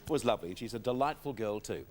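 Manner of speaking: normal talking voice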